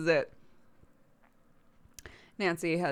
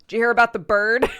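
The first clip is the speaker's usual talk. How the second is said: eager loud question; 4 semitones higher, 11 dB louder, faster than usual. Retold or imitated. Dad on call, eager.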